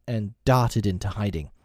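ordinary delivery

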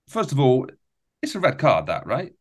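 ordinary delivery

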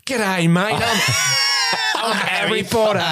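high, broad mock-Australian Harry Potter; hammed-up Australian accent, cracking up. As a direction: shout a cheesy Australian greeting. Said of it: "Why is he Australian?".

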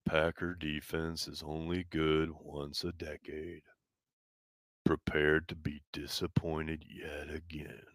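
slow, soft, mopey whisper; gloomy Eeyore voice. Shown as a demonstration. Reading a viewer's chat in a gloomy Eeyore voice. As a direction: read it like a sad donkey sighing. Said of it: "I gotta read it in the right voice".

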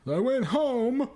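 high, slow, put-on comic voice; Dice voice. Shown as a demonstration. Comic redoing his punchline in the Dice voice. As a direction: hit it like a swaggering stand-up. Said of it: "I should have said it in the Dice voice".

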